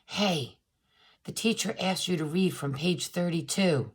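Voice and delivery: a soft, hushed tip to a classmate; whispered tip. Acted. Cool bean whispers the page number to him, softly. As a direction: lean in and murmur it kindly. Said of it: "She leaned in close and whispered".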